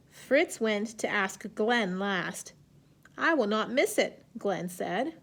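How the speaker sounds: slow, clear picture-book reading; reading to children. A narrator's reading. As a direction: read it gently to young kids. Teacher reads story aloud.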